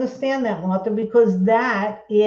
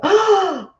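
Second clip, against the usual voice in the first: high, sudden gasp; surprised gasp; 9 semitones higher, 4 dB louder than usual. Real, not acted. Sudden alarm at her craft.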